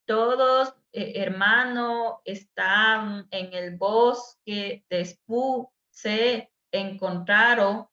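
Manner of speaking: very slow, word-by-word reading with long gaps; laboured, drawn-out dictation pace; deciphering read-aloud. Shown as a demonstration. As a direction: read haltingly, as if decoding a pupil's misspelt text. Reads a pupil's faulty writing.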